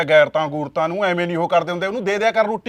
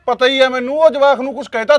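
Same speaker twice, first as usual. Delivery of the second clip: raised voice; 5 semitones higher, 6 dB louder than usual. Acted. Husband defends brother in quarrel.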